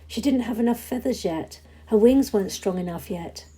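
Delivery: usual speaking voice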